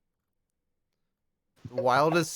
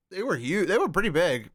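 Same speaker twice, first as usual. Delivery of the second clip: ordinary talk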